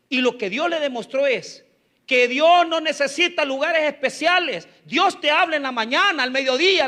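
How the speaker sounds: loud, high, driving sermon cadence; preaching at full force. Acted. Preacher proclaiming to the church that God speaks everywhere, in full-force preaching. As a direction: proclaim it to the congregation.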